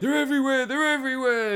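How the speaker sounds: high-pitched imitated panic, not shouted; mock freak-out. Reviewer retelling an astronaut smashing cameras, high mock-panicked cry. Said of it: "the guy freaks out".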